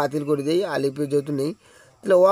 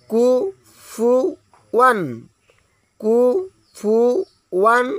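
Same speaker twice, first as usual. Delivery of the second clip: কুফুয়ান drawn out slowly and higher; slow syllable reading; 4 semitones higher, much slower than usual. Shown as a demonstration. Teacher chanting the word slowly for learners. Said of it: "কাটা কাটা উচ্চারণ".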